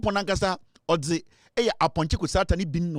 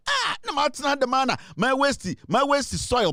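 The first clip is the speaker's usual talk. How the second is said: excited character; 7 semitones higher than usual. Acted.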